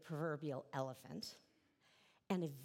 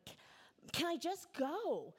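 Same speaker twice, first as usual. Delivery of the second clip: put-on frustrated child; 10 semitones higher than usual. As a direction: whine it out like a fed-up little girl begging to leave. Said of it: "I would get so frustrated".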